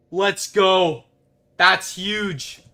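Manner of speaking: loud, high happy cheer; excited cheer. Real, not acted. Streamer cheering the find for chat. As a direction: celebrate out loud.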